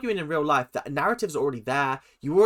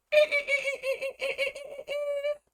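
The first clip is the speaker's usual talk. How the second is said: very high, chattering dolphin squeal; dolphin wail; 3 dB quieter than usual. Retold or imitated. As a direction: imitate a dolphin with rapid shrill squeaks. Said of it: "that dolphin whale".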